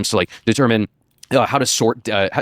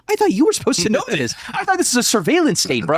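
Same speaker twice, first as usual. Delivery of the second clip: much higher, sarcastic retelling of his complaint; mock disbelief; 13 semitones higher than usual. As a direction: act amazed they can't find it. Mocking disbelief at slow police.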